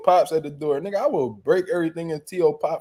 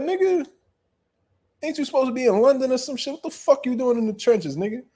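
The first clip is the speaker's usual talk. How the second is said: much higher and fast, incredulous; shocked disbelief; 9 semitones higher than usual. Retold or imitated. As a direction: blurt it out as if you can't believe your eyes. Shocked to see AJ at home.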